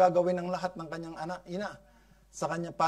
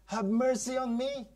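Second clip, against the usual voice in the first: higher, a mild crying plea; pleading cry; 7 semitones higher than usual. Acted. Canaanite mother crying to Jesus, high pleading plea. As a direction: beg for mercy like a desperate mother. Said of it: "cried unto him".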